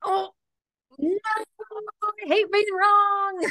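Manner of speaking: high, loud, slow wail of dismay; dramatic wail. Real, not acted. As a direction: wail it like a sore loser. Mock dismay at being disproved.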